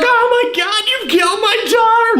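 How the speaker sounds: very high, screechy mock horror; hammed-up screaming mom. Mom finds her daughter killed, hammed-up high shriek. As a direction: shriek like a horrified mother.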